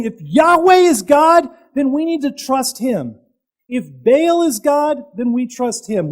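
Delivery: high, loud shout on Yahweh; bold challenge. Retold or imitated. Elijah challenges the people to choose, loud shout. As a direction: thunder out the challenge.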